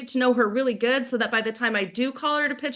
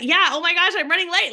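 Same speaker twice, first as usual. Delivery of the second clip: higher, louder voice of someone running late; acted fluster; flustered reply; 7 semitones higher, 4 dB louder than usual. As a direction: blurt it like a harried professional. Acting the rushed woman's reply.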